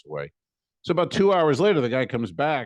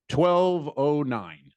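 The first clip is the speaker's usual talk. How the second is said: slow reading; 5 semitones higher, much slower than usual. Retold or imitated.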